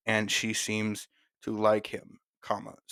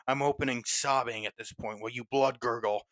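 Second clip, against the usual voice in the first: plain reading voice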